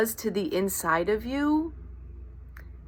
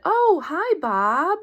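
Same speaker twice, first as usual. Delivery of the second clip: high bright mock greeting; chirpy greeting; 10 semitones higher, 4 dB louder, slower than usual. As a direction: imitate a cheery woman saying hi. Woman greeting in retold encounter.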